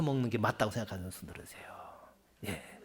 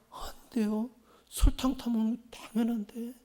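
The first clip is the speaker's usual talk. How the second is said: wife objecting; 7 semitones higher than usual. Retold or imitated. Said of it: "전라도 색시가".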